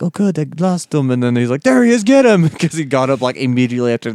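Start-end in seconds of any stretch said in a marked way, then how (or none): 0.0-1.1 higher, pleased goon voice; a thug thinking it's over; relieved henchman. Retold or imitated. Henchman relieved the hero got away, higher mock voice. 1.6-2.5 loud, high shout to chase; shouted order. Retold or imitated. Henchman spotting the hero and yelling to chase.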